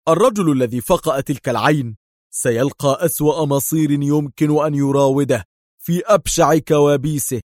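burn with rage at the threat; seething angry vow. A narrator's reading. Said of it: "اشتعلت نيران الغضب في ملامح دار وصوته".

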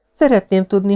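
put-on high-pitched girl's voice; questioning young woman. Girl turns the Marquis's question back on him, pointed. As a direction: echo his words, pitched well up.

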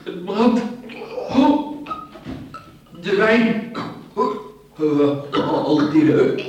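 loud, high cry of burning pain. Acted. Poisoned man crying out to his partner in agony.

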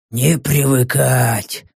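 low, slow phlegmatic reply; unbothered, drawn out slowly and low. A narrator's reading. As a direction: say it with stolid indifference. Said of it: "флегматично отозвался Каппа".

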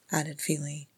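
ordinary delivery

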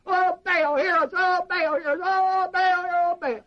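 high, loud frenzied chant; shouted mock pleading to Baal; hollering prayer. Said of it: "they whooped and they hollered".

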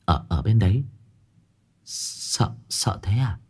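trembling, stammering, fading to a whisper; scared whisper. Acted. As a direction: ask in hushed fear, barely voiced. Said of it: "run bần bật".

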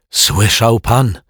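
out of breath from hurrying over; panting. A narrator's reading. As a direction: wheeze it out like after a run. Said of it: "wysapał pan Mietek".

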